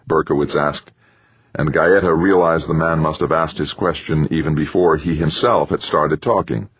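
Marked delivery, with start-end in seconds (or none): none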